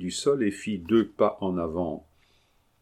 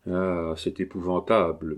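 ordinary delivery

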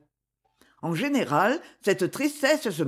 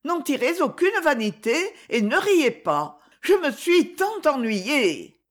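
put-on higher-pitched voice of a bored, wistful young woman; lightly acted complaint of a young woman; wistful young heroine; 6 semitones higher than usual. A narrator's reading.

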